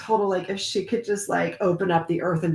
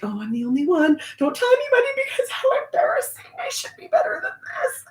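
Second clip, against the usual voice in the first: high, fast, breathy whispered panic; squeaky hushed mock shame; mock embarrassed inner voice; faster than usual. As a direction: whisper it like a secret shame, much higher. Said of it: "we think like".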